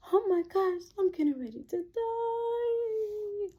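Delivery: high, soft drawn-out wail; mock dying panic. Looking at her veins in panic, acted soft wail. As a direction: act out a faint, tearful whimper, stretching the end. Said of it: "Just like that".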